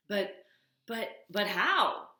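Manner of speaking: slow, loud stammering disbelief; stunned stammer. Acted. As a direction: sputter in amazement. Beaver astonished by fire.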